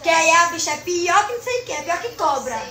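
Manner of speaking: usual speaking voice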